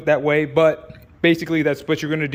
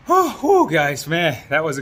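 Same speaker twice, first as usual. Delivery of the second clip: breathless after the install; panting between words; out of breath. Real, not acted. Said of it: "I'm out of breath".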